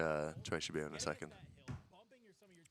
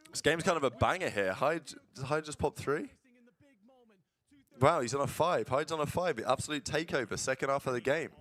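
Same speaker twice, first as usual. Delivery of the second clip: excited; 6 semitones higher than usual. Real, not acted. Watching a close match live.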